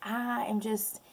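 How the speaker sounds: usual speaking voice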